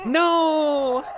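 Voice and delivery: high wailing no; dismayed cry. Real, not acted. Losing the bits.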